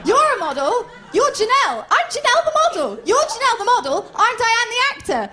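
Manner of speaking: high, loud, insistent back-and-forth; hammed-up frantic correcting; escalating mock argument. Acted mix-up escalating with model.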